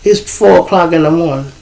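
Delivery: usual speaking voice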